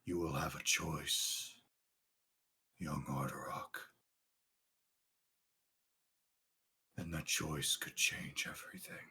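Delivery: low, hushed, mysterious narration; ominous whisper. Acted. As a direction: whisper it like a prophecy from the void.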